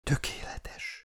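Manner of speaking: slow, very soft whisper. A narrator's reading. Frail patient whispering into her carrier's arm, whisper. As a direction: whisper it slowly, almost without voice. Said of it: "suttogta a felkarom izmaiba".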